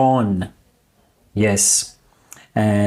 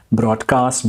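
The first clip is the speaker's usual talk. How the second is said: ordinary talk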